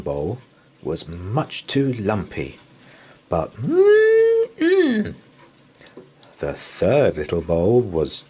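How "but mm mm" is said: high, loud yummy hum; delighted mm-mm. Acted. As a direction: hum with delight at tasty porridge. Goldilocks tastes right porridge.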